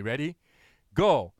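ordinary delivery

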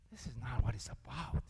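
hushed whisper as the Spirit. Retold or imitated. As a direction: whisper it gently to Ben. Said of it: "the Holy Spirit will say".

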